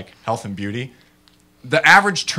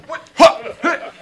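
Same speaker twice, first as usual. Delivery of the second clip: high, startled what-huh; confused shouts of disbelief; baffled; 7 dB louder than usual. Retold or imitated. Watching a kid run off with soap, startled and high.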